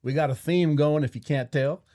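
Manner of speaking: put-on cowboy host's Western accent. Host announcing the Father's Day theme, put-on Western accent. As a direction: open with a lively cowboy greeting in Western accent. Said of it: "I don't know if I can do this accent".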